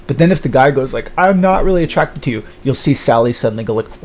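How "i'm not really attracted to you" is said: high, emphatic protest; acted rejection. Guy telling Sally he isn't interested, high-pitched protest.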